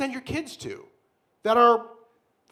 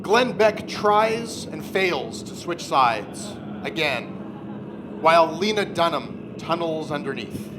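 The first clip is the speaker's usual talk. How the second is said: dramatic narration; 3 semitones lower than usual. Acted. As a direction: narrate it like a grim fantasy epic.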